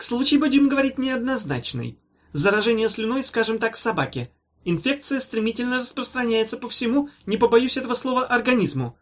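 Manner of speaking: high, mannered medical lecture; pompous professor. A narrator's reading. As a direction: pronounce the prognosis with fussy importance. Professor pompously delivers grim prognosis.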